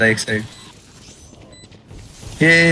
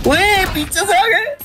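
alarmed yelp; slower than usual. Real, not acted. Enemies behind, alarmed.

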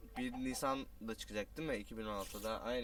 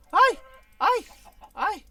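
high, loud frightened cries; startled yelps; 17 dB louder, slower than usual. Real, not acted. Gamer startled by an attacking creature, yelping in fright. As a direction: jump at a sudden attack.

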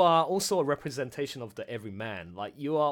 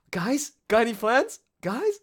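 lightly voiced nervous plea, higher; nervous call; 8 semitones higher, 6 dB louder than usual. Host imitating Tatiana asking the party for plans, higher nervous call. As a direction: call out to the group, worried. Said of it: "I love when you were like".

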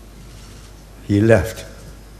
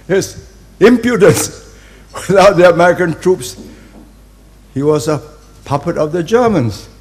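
laughing; 10 semitones higher, 9 dB louder than usual. Real, not acted. Laughing at de Gaulle's cheek.

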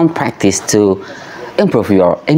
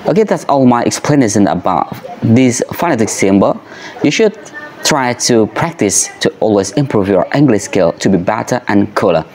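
normal talking voice

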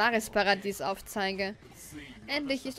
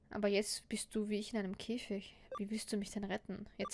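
whisper; 9 dB quieter than usual. Acted. Trapped together in cage.

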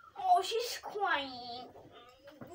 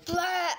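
much louder goofy blurt; silly loud blah; 3 semitones higher, 10 dB louder than usual. Real, not acted. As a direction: blurt it out loudly. Silly outburst.

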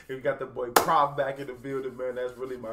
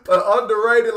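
excited; 7 semitones higher, 11 dB louder than usual. Real, not acted. Excited reaction after the verse.

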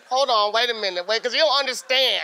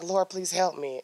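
quiet retold prayer; 4 semitones lower, 8 dB quieter than usual. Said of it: "I'm like".

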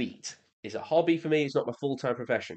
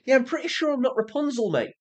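higher, quicker incredulous reply; incredulous retort; 9 semitones higher, faster than usual. Real, not acted. YouTuber answers a comment calling him Rapunzel, incredulous retort. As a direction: push back quickly in a higher pitch.

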